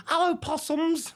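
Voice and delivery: high, drawn-out bad Australian greeting; hammed-up Australian dame, high and slow; shitey Australian accent. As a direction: put on a terrible Australian accent that slips into Indian. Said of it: "with my shitey Australian accent that sounds Indian".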